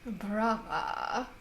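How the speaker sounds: soft, slowing, savoured count; fey lady. Acted. Fey lady congratulating the players on her joke, softly savoured.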